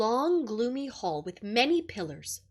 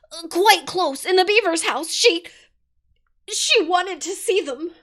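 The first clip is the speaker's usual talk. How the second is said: higher, stammering and frightened; trembling; 5 semitones higher, 9 dB louder than usual. A narrator's reading. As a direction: blurt it out through fear. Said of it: "trembling so that he could barely speak".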